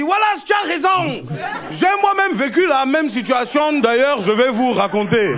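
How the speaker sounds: booming, high-pitched spectator outburst; shouting heckler. Retold or imitated. Man standing up from his seat mid-show, shouting to the comedian.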